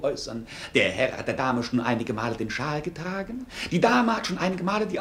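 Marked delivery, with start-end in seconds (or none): none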